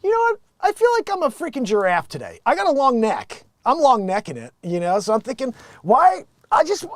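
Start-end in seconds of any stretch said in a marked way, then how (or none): 0.0-4.5 high, goofy self-declared giraffe; mock giraffe guy. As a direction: play a guy proudly announcing he's a giraffe. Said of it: "someone waking up and be like".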